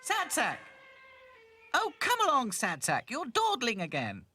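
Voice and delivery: high, loud scolding call. A narrator's reading. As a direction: call the straggler along impatiently. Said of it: "He could hear Dotty calling him".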